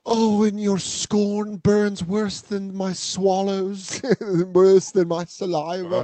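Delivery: slow, drawn-out theatrical woe; hammed-up lament, every word stretched; mock tragic diva. Friend joins in mocking the wife, slow melodrama. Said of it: "leaning over like Godiva".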